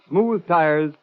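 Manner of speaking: ordinary talk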